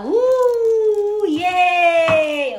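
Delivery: loud, high whoops of joy; high, loud excited cheer. Real, not acted. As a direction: whoop along with the riders. Cheering along with the ride.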